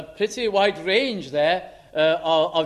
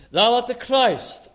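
louder, slower and higher; emphatic declaration; 4 semitones higher, 4 dB louder than usual. Shown as a demonstration. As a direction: proclaim it with weight. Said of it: "The thou".

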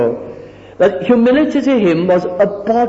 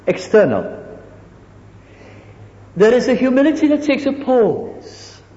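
usual speaking voice

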